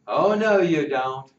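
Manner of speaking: loud playful call; ringing out. Acted. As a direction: stop him from going back to bed. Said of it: "Slim rang out".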